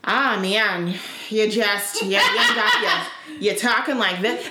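exaggerated Minnesota accent, laughing; hammed-up Minnesota vowels; picked-up Minnesota accent. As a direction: slip into a Minnesotan voice. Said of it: "I pick up accents very quickly".

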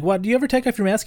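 a hint of a high curious kid. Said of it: "their kids are like".

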